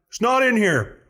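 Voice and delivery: high incredulous voice; retold high mock letdown; mock dismay. Man checking helmet.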